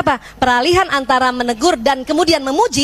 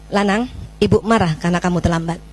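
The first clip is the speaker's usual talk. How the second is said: lower, steady calm statement of anger; right, calm way; 7 semitones lower than usual. Shown as a demonstration. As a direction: own your anger, calmly and gently. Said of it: "itu, itu yang keliru".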